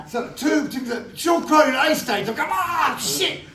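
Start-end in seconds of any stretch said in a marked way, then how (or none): 2.5-3.4 high, sudden alarmed exclamation; panicked curse. Retold or imitated. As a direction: caught out, blurt it in panic. Realises he's called to set.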